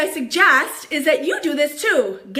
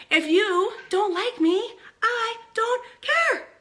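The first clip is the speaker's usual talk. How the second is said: slow, higher, defiant don't care; harsh dismissal; 8 semitones higher, slower than usual. Acted. Dismissing people who dislike her, slow and defiant. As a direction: drag it out, pitched up and cold. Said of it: "That sounds so harsh".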